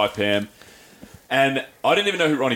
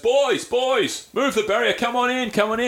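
higher, hearty and welcoming; friendly giant welcome; 11 semitones higher, slower than usual. Retold or imitated. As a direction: wave them in warmly. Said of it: "And he goes".

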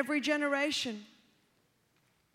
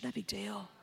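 hushed coax; 6 semitones lower, 13 dB quieter than usual. Retold or imitated. Tempting voice, repeated.

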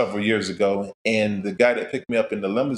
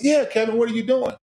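bright, much higher, happy surprise on a phone call; higher pitched delighted hello; surprised greeting; 12 semitones higher than usual. Retold or imitated. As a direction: greet an old friend who calls out of the blue.

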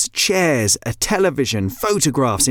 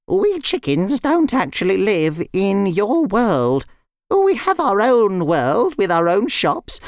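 high, put-on hen voice; explaining chicken; 8 semitones higher than usual. Nuala explains the chickens' world to the girls, explaining. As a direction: explain carefully in a high character voice.

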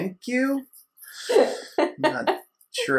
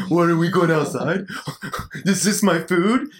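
put-on dopey teen dog; 4 semitones higher than usual. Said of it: "He's just like".

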